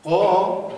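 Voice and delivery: قا said very loud with the mouth opened vertically; vertical mouth vowel. Shown as a demonstration. Teacher shows mouth shape to students, loud. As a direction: open wide and project قا.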